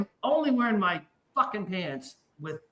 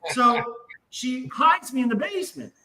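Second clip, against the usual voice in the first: ordinary talk, nothing marked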